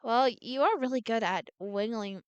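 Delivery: ordinary delivery, nothing marked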